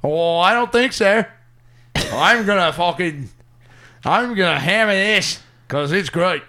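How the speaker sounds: loud mock Australian comic voice, brash and swaggering; Jim Jeffries impression. Australian accent as Jim Jeffries, mocking his stage rant. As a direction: bark it like an Australian comedian on stage. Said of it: "I'm Jim Jeffries".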